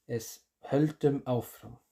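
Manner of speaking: höldum áfram said slowly in Icelandic; teacher models the phrase for learners. Shown as a demonstration. Slow Icelandic phrase. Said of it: "la palabra seguir en islandés".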